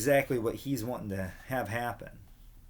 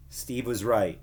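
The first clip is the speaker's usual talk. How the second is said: ordinary delivery, nothing marked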